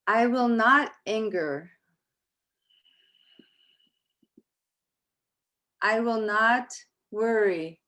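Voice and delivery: measured English principles with long pauses between lines; slow recitation. Shown as a demonstration. Teacher leading the class in the English principles, slow and calm. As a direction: recite each line for the class to echo. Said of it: "We're gonna say them slowly".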